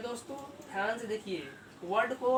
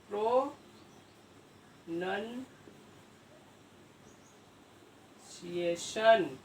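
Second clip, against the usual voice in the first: Pronunciation extremely slow, with long pauses between parts; pro... नन... सिएशन, dragged out piece by piece; syllable by syllable; much slower than usual. Real, not acted. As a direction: spell out pronunciation by sound as you write it. Sounding out word while writing.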